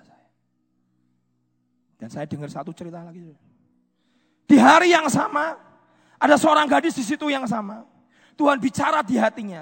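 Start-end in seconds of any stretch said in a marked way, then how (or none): 4.5-9.6 loud preacher launching a new story; impassioned preaching. Real, not acted. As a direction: open the story at pulpit volume. (khotbah bercerita dengan suara lantang)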